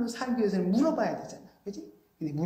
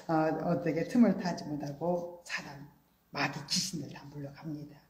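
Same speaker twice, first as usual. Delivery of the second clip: ordinary talk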